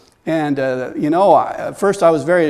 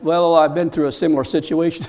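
normal talking voice

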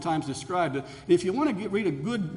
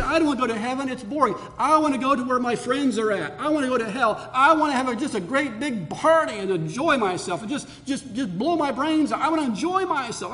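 higher, louder mock of a careless partygoer; raised mocking partygoer voice, higher and louder; mocking whine; 6 semitones higher, 3 dB louder than usual. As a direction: ham up a sinner who just wants the party. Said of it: "And people say".

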